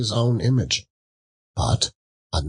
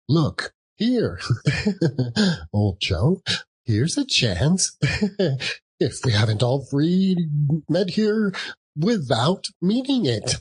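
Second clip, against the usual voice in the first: laughing charwoman; 7 semitones higher than usual. A narrator's reading. Charwoman laughing to old Joe.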